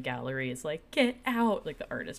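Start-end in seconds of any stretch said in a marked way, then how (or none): 0.9-1.6 shouting. Retold or imitated. Gallery crowd kicking them out.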